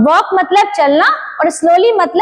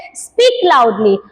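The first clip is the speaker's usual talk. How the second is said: lower, drawn-out teacher command; slow model phrase; 5 semitones lower, much slower than usual. Shown as a demonstration. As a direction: stretch it like a teacher calling. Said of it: "तो teacher बोलती है".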